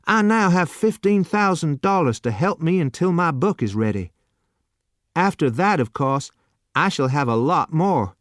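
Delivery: put-on hopeful young man, a touch excited; young Lexington dreaming aloud of his book; eager young Lexington. A narrator's reading.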